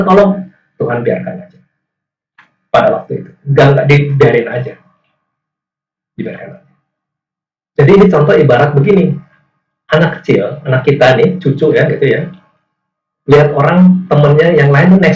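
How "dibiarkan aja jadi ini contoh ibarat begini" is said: ordinary talk, nothing marked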